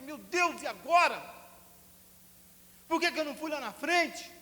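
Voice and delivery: high, loud despairing cry; anguished cry. Retold or imitated. Boy crying out to God, loud and anguished. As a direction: wail over the missed chance.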